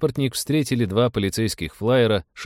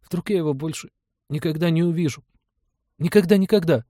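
put-on distraught child, much higher pitch, rising in level; a small boy on the verge of tears; Рики; 10 semitones higher than usual. A narrator's reading. Boy dreads never seeing dad again, distressed high child voice. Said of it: "Губы Рики задрожали".

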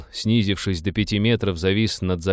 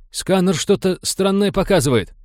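high-pitched, worried warning; alarmed report; 10 semitones higher, 3 dB louder than usual. A narrator's reading. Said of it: "встревоженно окликнул черепа пилот".

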